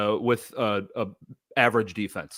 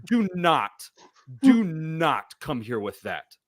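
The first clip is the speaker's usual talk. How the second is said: higher, slow mock scolding; 5 semitones higher, much slower than usual. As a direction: scold him in drawn-out, separated words. Mock-scolding absent Nick over a bad take.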